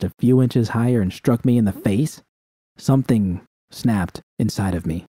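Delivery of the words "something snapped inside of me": usual speaking voice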